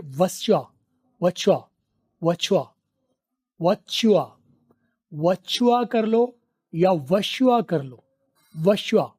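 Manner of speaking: "वच्चा" said as one quick run for what's your; reduced what's your. Shown as a demonstration. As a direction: squash what's your into "वशुआ". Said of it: "What is your को कैसे बोलेंगे?". (what is your को "वच्चा" या "वशुआ" की तरह मिला कर बोलो)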